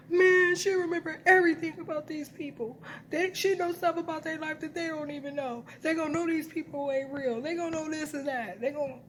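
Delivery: high whiny complaining voice; whining voice. Retold or imitated. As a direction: whine it out like a sulky man. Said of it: "he always does it in a whining voice".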